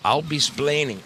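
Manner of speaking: mock Ricky Ricardo, "splaining"; Ricky Ricardo line. Shown as a demonstration. To his viewers, a mock Ricky Ricardo accent.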